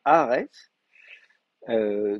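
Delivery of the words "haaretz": "Haaretz" with the stress on RE, lifted and louder. Shown as a demonstration. Teacher marks the stressed syllable of "Haaretz" for students. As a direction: punch the middle syllable of "Haaretz". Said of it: "donc l'accent il tombe, euh, ici".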